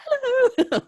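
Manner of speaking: squeaky high-pitched hello; a silly falsetto greeting, hammed up; mock high hello. Mocking a cheery falsetto hello to the crowd.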